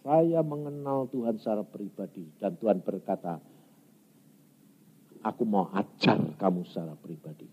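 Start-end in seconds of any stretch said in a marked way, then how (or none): 5.2-7.5 low, hushed voice of God; gentle divine promise. Retold or imitated. God promising to teach the preacher personally, gentle and low. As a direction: speak it low and very softly.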